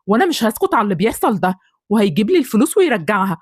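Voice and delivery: ordinary talk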